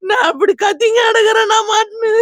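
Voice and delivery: loud tearful wail; words torn by sobbing; crying out. Real, not acted. Grandmother wailing to the reporter about the attack, crying loudly.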